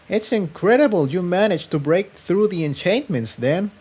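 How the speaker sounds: lightly voiced girl, admiring; impressed young mare. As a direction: pitch up, marveling at his skill. Tiptoe impressed.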